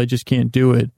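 normal talking voice

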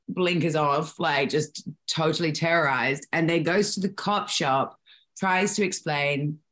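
ordinary talk, nothing marked

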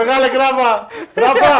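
loud, high call across the room. Real, not acted. Calling the guest in.

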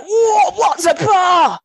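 loud, high-pitched shout; startled outburst. Retold or imitated. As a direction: shout it slowly in hammed-up shock. Acting out their first startled reaction.

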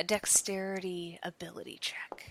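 hushed whisper aside; breathy whispered aside. Real, not acted. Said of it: "whispered rules aside".

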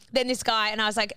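ordinary talk, nothing marked

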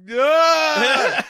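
high, loud mock scream. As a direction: shriek with a laugh. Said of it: "I almost wanted him to scream".

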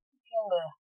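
ordinary delivery

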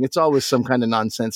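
ordinary talk

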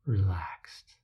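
breathy whispered repeat; whispered echo. Acted. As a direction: let the word dissolve into a whisper. Repeated word, now whispered.

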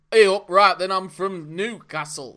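mock Geordie, which drifts off; attempted Geordie accent. Shown as a demonstration. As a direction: have a bad go at Geordie. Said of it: "No, you're doing Geordie".

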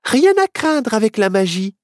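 high, confident little witch; cocky high-pitched reassurance; self-assured. A narrator's reading. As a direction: brush off the worry breezily. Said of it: "Sûre d'elle".

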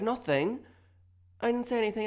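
high-pitched, defensive denial; innocent denial. Acted. Arguer denying the muttered insult, high and innocent.

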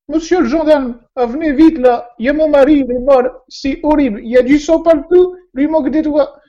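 high, loud shrieking woman's voice; panicked widow. Acted. Widow calling the police in a high, loud panic.

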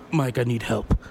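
low, lightly acted plea for help; subdued, dropped-pitch admission; mock confession. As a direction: imitate a caught employee owning up. Acting out caught employee's confession.

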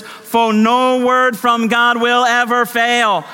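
high, slow, drawn-out proclamation; ringing, sustained preacher's cry; full-force preaching. Real, not acted. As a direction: proclaim it from the pulpit, stretching every word.

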